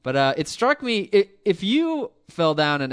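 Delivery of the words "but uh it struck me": ordinary talk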